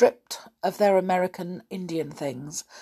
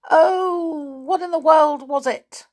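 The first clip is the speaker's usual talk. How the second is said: dismayed; 11 semitones higher, 6 dB louder than usual. Acted. Trying to recall password.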